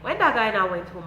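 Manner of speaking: ordinary delivery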